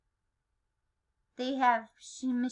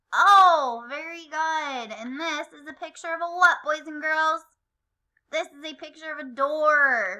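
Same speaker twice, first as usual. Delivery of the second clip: normal talking voice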